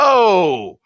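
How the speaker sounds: loud, high startled whoa; a retold gasp of amazement; surprised whoa. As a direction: blurt it out in surprise. Reacting as [name] is announced.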